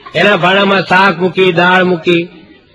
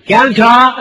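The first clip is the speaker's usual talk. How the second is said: louder and higher, a cheery call; loud greeting; 5 semitones higher, much slower than usual. Retold or imitated. Wife greets heart-patient uncle far too loudly, louder and higher. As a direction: bellow hello at a sick uncle's bedside. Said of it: "જોશથી નહીં બોલવાનું".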